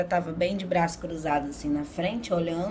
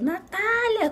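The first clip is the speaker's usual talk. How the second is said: high, drawn-out cry for Natália; retold desperate yell; 4 dB louder, much slower than usual. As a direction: call out desperately, pitch soaring, re-enacting it. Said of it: "Comecei a gritar por Natália".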